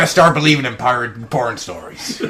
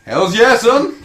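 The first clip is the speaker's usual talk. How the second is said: excited cheer; 7 semitones higher, 6 dB louder, slower than usual. Real, not acted.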